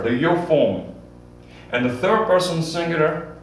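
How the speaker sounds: ordinary talk, nothing marked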